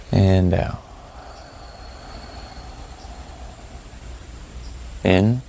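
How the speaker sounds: calm, spacious delivery, letting each phrase settle; guided meditation. Real, not acted. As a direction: lead them through the breathing, unhurried and even.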